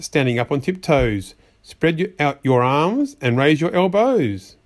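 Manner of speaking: ordinary delivery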